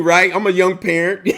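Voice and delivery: usual speaking voice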